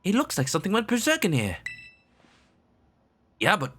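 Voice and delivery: put-on surprised kid in a wrecked lab; bright, high and startled; high young character voice. Voices the game's dialogue.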